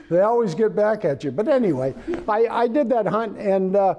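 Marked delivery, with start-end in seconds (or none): none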